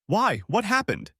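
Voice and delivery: high, alarmed cry of panic; panicked groom asking what happened; alarmed shriek. Acted. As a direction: blurt it out in sudden fright. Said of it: "I shrieked in alarm".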